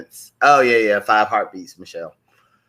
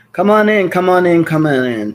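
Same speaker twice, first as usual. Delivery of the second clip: calling viewers in; 5 semitones higher, 5 dB louder than usual. Real, not acted. Host greets live viewers in.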